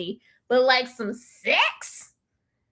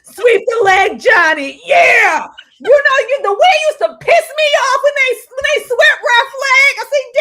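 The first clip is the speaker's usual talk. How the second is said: high, loud outraged cry; raging about Ralph's leg being swept in the movie; anguished rant; 10 semitones higher, 7 dB louder than usual. Real, not acted. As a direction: wail it like the injury was yours.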